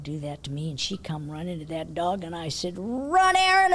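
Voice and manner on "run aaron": louder, higher urgent call; telling Aaron to run from the charging cat; yelled warning. Retold or imitated. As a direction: shout it to get her running. Yelling warning about the dog.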